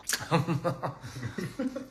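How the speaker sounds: soft breathy giggle; light embarrassed laugh. Real, not acted. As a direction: laugh at yourself quietly. Laughing at herself.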